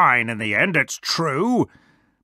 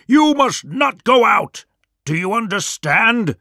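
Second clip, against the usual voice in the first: higher, emphatic parental order; stern warning; 8 semitones higher, 3 dB louder than usual. Acted. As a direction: lay down the law to the cubs. Big Wolf forbids the cubs.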